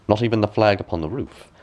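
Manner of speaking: plain reading voice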